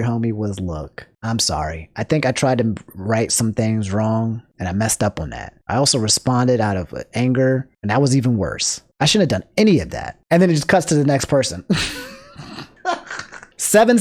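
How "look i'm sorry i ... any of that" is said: lightly voiced contrite Linus; Linus's ideal apology. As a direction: voice the apology he should have given, low and plain. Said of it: "easiest thing you could have said here".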